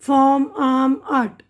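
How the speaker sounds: फॉर्म, आर्म, आर्ट as "fawm, aam, aat"; फॉर्म, आर्म, आर्ट with long vowels and no R; silent R. Shown as a demonstration. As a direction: list फॉर्म, आर्म, आर्ट with every R dropped. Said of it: "आर silent होता है यहाँ पे".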